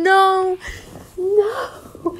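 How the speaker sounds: rising high cries of no; anguished no. Acted. Widower hearing the nurse's news, anguished cries.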